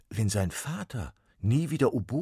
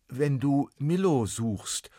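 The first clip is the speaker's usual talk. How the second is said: put-on higher, louder, slow frightened boy; startled boy; 8 semitones higher, 8 dB louder, slower than usual. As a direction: voice young Max, unsure who speaks. Max realizes.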